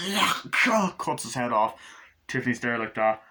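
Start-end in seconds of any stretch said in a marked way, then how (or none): 0.1-0.9 high, loud yeah; imitating Glenn. Glenn before cutting the head off, high cry. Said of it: "Glenn just goes".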